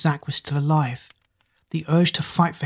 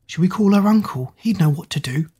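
ordinary delivery, nothing marked

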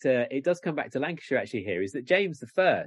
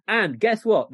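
emphatic agreement, higher and louder; lightly acted gentry, raised and insistent; local gentry replying; 6 semitones higher, 5 dB louder than usual. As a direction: lean into it like an eager local complainant. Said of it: "gentry sort of say to him".

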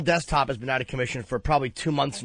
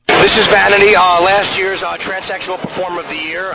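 booming host intro, much louder; loud announcement; 4 semitones higher, 10 dB louder than usual. Real, not acted. Radio host introducing the guest in a loud booming announcement. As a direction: announce the guest to the whole room.

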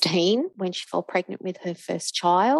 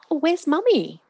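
put-on high, light voice for little kids; put-on chirpy child-directed question; asking small children; 11 semitones higher, 4 dB louder than usual. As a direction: re-enact a grandma's casual question to the children. Asks the grandchildren for their mother.

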